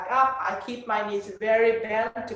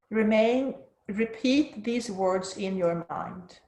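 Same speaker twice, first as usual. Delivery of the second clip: soft spa whisper. Acted. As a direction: soothe them into stillness, unhurried. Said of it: "let's move into the guided meditation".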